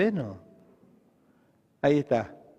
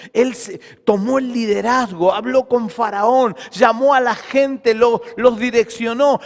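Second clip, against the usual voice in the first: emphatic list, building to a loud peak; building preaching; 5 semitones higher, 7 dB louder than usual. Real, not acted. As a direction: drive each deed home, louder at the end. Preaching builds on Moses' deeds. (prédica enfática que sube de intensidad)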